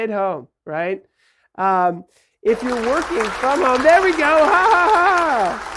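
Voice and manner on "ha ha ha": fake laugh. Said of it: "People are laughing".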